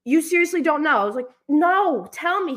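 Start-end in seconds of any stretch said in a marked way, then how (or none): 0.0-1.0 surprised. Retold or imitated. Dad quoted in disbelief. 1.5-2.6 eager. Retold or imitated. Quoting own eager plea.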